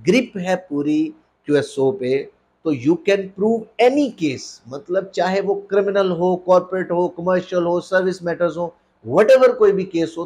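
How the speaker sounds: ordinary talk, nothing marked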